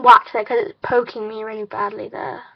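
talking with lip held down; lip pulled down by fingers. Real, not acted. Seen 0: Two fingers pull lower lip down while speaking, showing braces.

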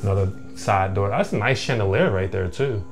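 normal talking voice

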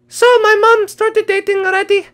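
ordinary delivery

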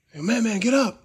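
high, loud call to get up; urgent shout. Retold or imitated. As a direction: shout at a fallen friend. Friend on the ground.

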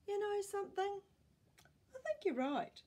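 put-on high pleading old man voice; pleading Grandpa. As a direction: plead to keep the cardigan.

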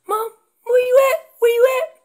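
put-on very high, loud anxious-kid holler; strayed calf calling. Calf too far from Mom, high urgent shouting. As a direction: cry out for Mom from far away. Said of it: "calf ventured way away from Mom".

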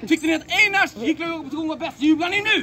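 rally the crowd to cheer; host shouting to the audience for cheers; calling to the crowd. Acted.

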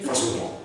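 "François Dumont" softly, with silent final t; French pronunciation. Shown as a demonstration. Student repeating "François Dumont" when asked, French pronunciation. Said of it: "your mom understands what that means".

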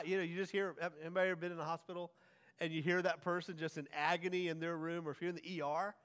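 ordinary talk, nothing marked

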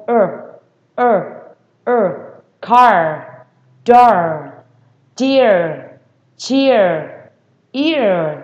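loud drawn R on the tail of each word; curled final R. Shown as a demonstration. Teacher showing the word's tail, curled R. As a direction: curl the tongue into the final "er" of car, door, dear, chair, ear. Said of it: "Cái đuôi cuối cùng tôi".

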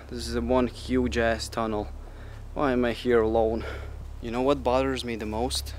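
ordinary talk

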